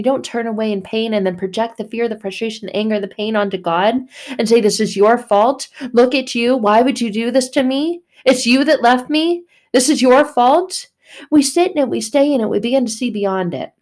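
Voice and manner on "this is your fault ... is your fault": loud, accusing cry at God; angry blaming. Acted. Believer shouting blame at God, angry.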